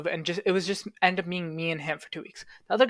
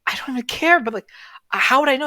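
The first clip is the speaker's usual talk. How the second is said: higher, louder retold protest; recalling his own baffled, louder reply; exasperated retort; 8 semitones higher, 6 dB louder than usual. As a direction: push back at the roommate in disbelief. He answers the roommate's accusation.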